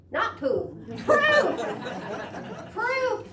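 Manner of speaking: high, loud shriek from nowhere; screamed. A narrator's reading. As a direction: shriek it with a cackling laugh. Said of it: "screamed a voice out of nowhere".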